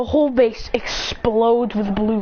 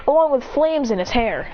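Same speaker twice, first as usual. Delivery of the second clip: usual speaking voice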